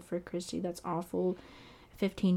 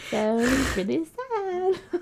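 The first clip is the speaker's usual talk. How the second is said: laughing through the words, slow; nervous laugh while talking; 9 semitones higher, 3 dB louder, much slower than usual. Real, not acted. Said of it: "All this laughing is nervous laughter".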